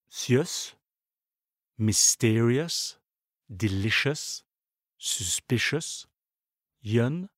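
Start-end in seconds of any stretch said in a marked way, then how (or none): 1.8-6.1 "mysterious, delicious, suspicious", each leaning on the syllable before the ending; stress before suffix. Shown as a demonstration. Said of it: "sont accentués sur l'avant-dernière syllabe".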